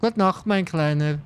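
ordinary talk, nothing marked

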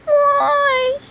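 high squeaky toy voice answering; high plush voice. Pretend. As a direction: play the grumpy plush brother. Plush whines back.

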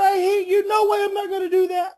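high imitation of a refusing child; lightly voiced defiant kid; mock child refusal. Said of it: "as soon as they say".

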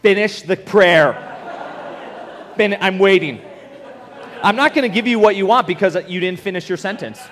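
a fed-up God tapping his foot; mock-stern God scolding a child who stopped praying; impatient God. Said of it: "I thought God was like".

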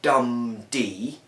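DUM-d, falling beat; trochee demo. Shown as a demonstration. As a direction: hit dum hard then drop to D. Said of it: "which is a".

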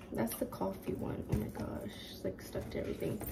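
whispered aside. Real, not acted. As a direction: whisper it quietly.

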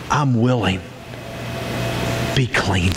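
quietly tender command; intimate, low. Retold or imitated. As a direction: close to his face, gently. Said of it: "he got right up in his face and he said".

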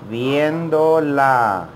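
"Viéndola" drawn out slowly, each syllable long. Shown as a demonstration. Teacher slowing down for students to repeat, very slowly. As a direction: stretch it out for learners to copy. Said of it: "I'm gonna say it very slowly".

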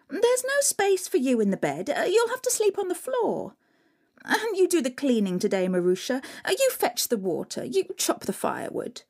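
put-on high, sneering spoiled girls; mean stepsisters. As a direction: taunt her, snooty and cruel.

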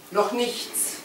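ordinary delivery, nothing marked

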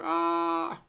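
high, strained scream of horror; anguished scream. Retold or imitated. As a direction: let out a horrified shriek. Wanda freaks out.

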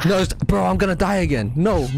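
high panicked cries; panicking gamer. Real, not acted. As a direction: panic as she closes in. Chased by the killer, about to die.

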